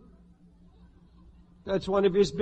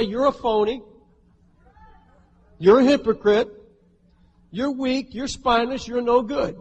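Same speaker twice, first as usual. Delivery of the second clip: pointed, higher-pitched accusations; accusing devil; 4 semitones higher, 4 dB louder than usual. Acted. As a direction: jab each insult as the accuser. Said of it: "He'll say".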